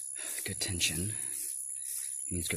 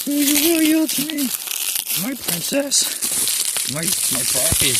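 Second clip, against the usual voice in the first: hammy villain; 10 dB louder than usual. Pretend. Playing villain to the plant, hammy high villain. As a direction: gloat like a cartoon villain.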